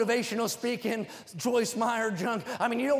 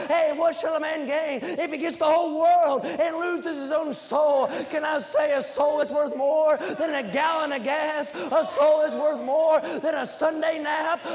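full-force preaching; 4 semitones higher than usual. Real, not acted. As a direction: preach it out to the whole church, punching each phrase.

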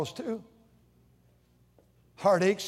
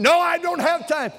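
busy mom snapping back, higher and louder; snapping mother; 8 semitones higher, 4 dB louder than usual. Retold or imitated. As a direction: brush the child off sharply. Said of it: "a little kid says to her".

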